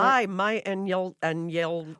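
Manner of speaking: "Hi, my and yell" said slowly as reversed speech; backwards speech. Shown as a demonstration. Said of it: "Can you do ask".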